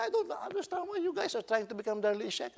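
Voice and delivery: high-pitched, loud retold scolding; exasperated rebuke. Preacher stands up to challenge imitators of a singer, exasperated and high.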